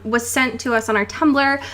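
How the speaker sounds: ordinary talk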